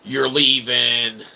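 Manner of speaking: normal talking voice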